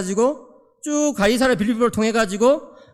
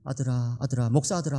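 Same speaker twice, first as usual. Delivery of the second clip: low, soft mock-solemn dad; father addressing son; 8 semitones lower, 7 dB quieter than usual. Pastor father answers his protesting son, low and hushed. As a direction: quietly call the boy to attention. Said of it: "그래서 제가".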